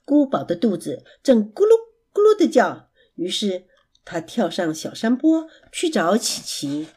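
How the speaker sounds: ordinary delivery, nothing marked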